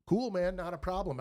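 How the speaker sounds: loud, high fake-casual cool; mock nonchalant cool, hiding excitement; poker face. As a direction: act totally unfazed, playing it cool. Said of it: "trying to play that poker face".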